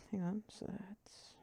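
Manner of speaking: whispered aside to himself; whispering. Real, not acted. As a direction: breathe it under your breath. GM muttering to himself.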